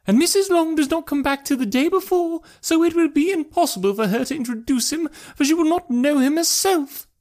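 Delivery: very high-pitched, agitated mother; put-on high voice, lamenting; mother crying out. A narrator's reading. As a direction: voice a dismayed mother, pitched right up. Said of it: "cried her mother".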